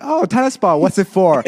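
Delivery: put-on young woman. Wristband attendant asking about the ball, imitated young woman. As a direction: pitch it up like a curious young attendant. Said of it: "she's, like, young".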